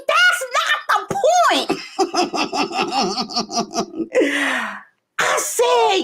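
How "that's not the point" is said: high-pitched mock exasperation; hammed-up, high retold objection; exasperated protest. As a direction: protest in a squeal, close to laughing. Acting out exasperation with son.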